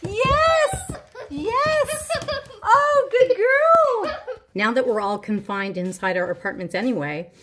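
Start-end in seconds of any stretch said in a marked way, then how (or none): 0.0-4.4 high, exuberant cheering; excited dog praise. Real, not acted. Owner cheering the dog through the course, excited praise. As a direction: draw it out with delight.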